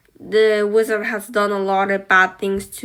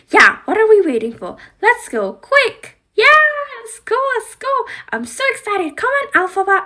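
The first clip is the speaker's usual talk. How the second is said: high, loud, fast cheering; squealing let's go; excited; 13 semitones higher, 6 dB louder, faster than usual. Pretend. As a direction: bounce with excitement to leave. Doll character eager to go.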